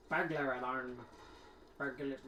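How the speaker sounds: Scottish accent attempt. Shown as a demonstration. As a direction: test "burglar" in a struggling Scottish accent. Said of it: "say it very well with the Scottish".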